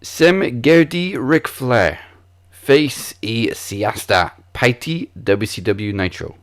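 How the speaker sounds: Sem gerði Ric Flair read slowly in Icelandic; Icelandic pronunciation. Shown as a demonstration. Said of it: "This question in Icelandic is".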